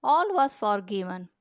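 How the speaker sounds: ordinary delivery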